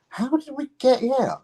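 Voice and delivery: high, wondering question; awed wonder. Retold or imitated. Musician gazing over Tokyo, high and awed. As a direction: marvel at how far you've come.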